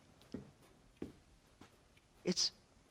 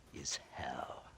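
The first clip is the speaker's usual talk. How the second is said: much lower and softer, breathed out as a whisper; menacing whisper; 11 semitones lower, 12 dB quieter than usual. Acted. Agent warns Marston the alternative is hell, whispering. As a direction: let the word drop to a threatening whisper.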